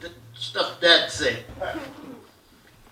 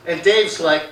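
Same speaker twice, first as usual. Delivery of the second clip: usual speaking voice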